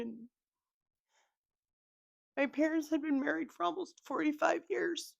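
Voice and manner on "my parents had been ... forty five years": tearful, high-pitched telling; crying. Real, not acted. Daughter talking about her late parents, crying. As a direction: hold on through real tears. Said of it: "sorry for crying like this".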